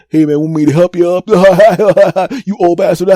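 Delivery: imitating a heckling buddy, high and loud; jeer, cackling after each jab; mocking, teasing laughter. Friends breaking his stones at the gym, mock laughing jeers. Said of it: "then breaking my stones".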